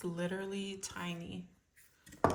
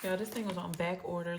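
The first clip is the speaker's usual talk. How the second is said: ordinary talk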